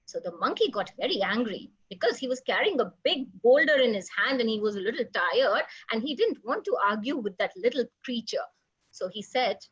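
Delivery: plain reading voice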